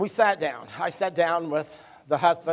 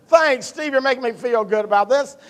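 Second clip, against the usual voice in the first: sarcastic thanks; 6 semitones higher, 5 dB louder than usual. Retold or imitated.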